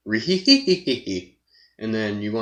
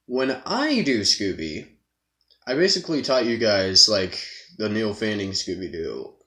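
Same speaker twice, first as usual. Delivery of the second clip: ordinary delivery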